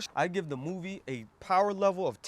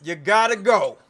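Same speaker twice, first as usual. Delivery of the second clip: louder, final and decisive; firm loud dismissal; 4 semitones higher, 8 dB louder than usual. Acted. Reviewer loudly cutting off friends after the movie. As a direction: slam the door on every friend.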